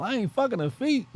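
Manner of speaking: high, far put-on Martin voice; Martin impression. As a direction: quote Martin from Boomerang in his voice. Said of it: "Isn't that what Martin said?".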